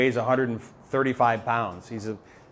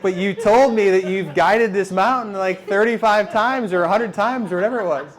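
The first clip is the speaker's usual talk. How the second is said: loud, high comic disbelief; mock incredulity; 8 semitones higher, 5 dB louder than usual. Climber to guide who confessed a first summit, amused incredulity. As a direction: challenge him, pitched up and loud.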